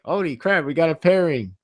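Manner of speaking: high-pitched delighted disbelief into a cheer; marvel, then draw out a little yay; mock celebration. Retells own delighted reaction to pairing.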